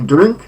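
drink with a short i shading into Polish y. Shown as a demonstration. Teacher models the first form, short-vowelled drink. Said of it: "krótkie I przechodzące w Y".

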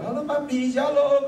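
high-pitched imitation of a little girl; little girl's greeting. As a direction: greet Papi like an excited small daughter. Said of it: "anak gadis kecil ini datang".

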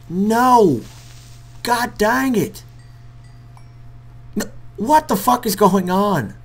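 high, loud, drawn-out complaint; rising fury at the mobs; exasperated yelling. Real, not acted. Gamer cursing at the mobs attacking him, exasperated yell.

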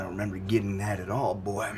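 suddenly deep, controlled voice; low menace. Acted. Host warning caller, low menacing voice. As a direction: drop right down low, as a warning. Switch from wail to threat.

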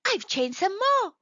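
very high, thrilled squeak; excited. A narrator's reading. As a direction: burst with delight. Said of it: "he thought excitedly".

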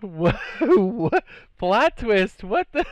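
can't believe what just happened; high startled repeated what; stunned disbelief. Real, not acted. Stunned after being shot.

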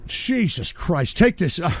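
ordinary delivery

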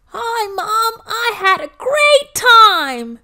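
put-on a kid who can't hold in how fun it was; excited child. Joe telling Mom about the party, excited and high.

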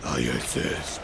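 sinister deep insistence; deep menacing growl. Pretend. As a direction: threaten them in a deep monster voice. Pig answers menacingly.

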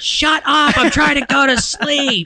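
high-pitched mock wife yelling; annoyed wife. Wife trying to sleep, high annoyed comic yell. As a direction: holler like an irritated spouse in the background. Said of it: "your wife's in the background".

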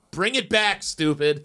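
high, sharp mock scold; scolding mom.

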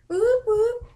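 two high celebratory whoops; playful whoops. Pretend. Vlogger showing off a costume, high playful whoops. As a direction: whoop twice, high in pitch.

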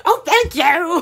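normal talking voice

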